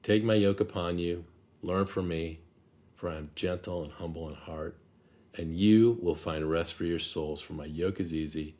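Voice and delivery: soft, unhurried scripture reading; read slowly. Shown as a demonstration. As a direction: let each phrase sink in, gently and quietly. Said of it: "I'll read them slowly".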